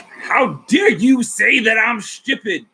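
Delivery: high, slow, shouted indignation; mock outraged woman. Angry woman cursing him out over woke, high mock shout. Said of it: "She cursed me out for about".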